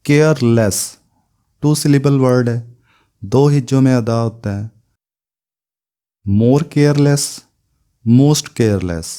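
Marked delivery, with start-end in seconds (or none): 6.2-9.1 drawn-out, word by word; very slow. Shown as a demonstration. Teacher modelling forms for students, very slow. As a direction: lay out each form slowly for the class. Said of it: "teacher demonstrates comparative forms".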